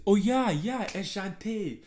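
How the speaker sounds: hyped-up quote. Retold or imitated. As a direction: belt it out like you nailed it. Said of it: "You have to say".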